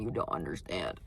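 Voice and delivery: ordinary delivery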